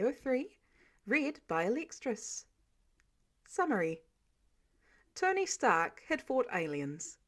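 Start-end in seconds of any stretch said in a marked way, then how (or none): none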